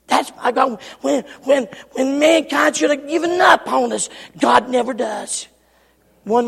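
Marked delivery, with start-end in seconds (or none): none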